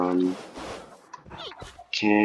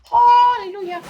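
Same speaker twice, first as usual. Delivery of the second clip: much higher, loud joyful cries; overjoyed; 11 dB louder, much slower than usual. Real, not acted. To viewers, celebrating the mutant panda with high joyful cries. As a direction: cheer like a miracle happened.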